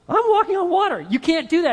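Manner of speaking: high, loud burst of alarm; panicked realization. Acted. Peter realizing he is on the water, alarmed. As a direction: blurt it in sudden mock panic.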